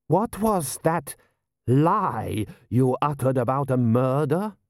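measured demand, stretching the words; slow, pointed questioning; affronted general. Acted. General questioning a reported lie.

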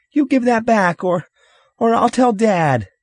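put-on high, loud child's threat; shrill up like an outraged little brother; angry child. Younger brother threatening to tell Dad, angry high voice.

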